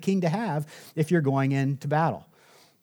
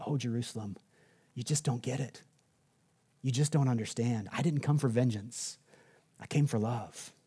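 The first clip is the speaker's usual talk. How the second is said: softer, lower, a touch of tearful sorrow; tearful lament; 4 semitones lower, 8 dB quieter than usual. Retold or imitated. Jesus weeping over Jerusalem, soft and sorrowful. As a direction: mourn over the city quietly. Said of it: "he starts crying".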